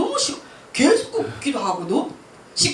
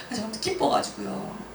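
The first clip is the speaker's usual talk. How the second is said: normal talking voice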